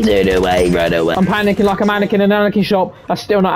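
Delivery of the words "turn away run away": much lower, heavy run away; mock deep voice. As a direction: drop the voice low and dopey. Same cry repeated in a low voice.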